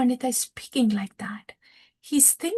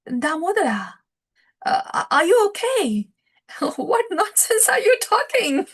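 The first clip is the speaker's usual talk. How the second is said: high-pitched, incredulous and half laughing; acted disbelief; 8 semitones higher, 4 dB louder than usual. Lord Chaitanya answering his scolding associate, acted amused disbelief. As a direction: question his sanity in a raised, amused voice.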